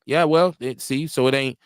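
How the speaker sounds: normal talking voice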